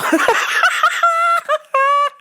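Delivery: long high laugh. Real, not acted. Laughing over the broken pencil story, high. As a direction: let it rip high and long.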